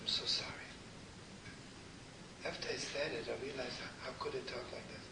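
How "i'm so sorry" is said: hushed, barely voiced apology; a weeping bride's whispered sorry; tearful whisper. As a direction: whisper it as if through tears.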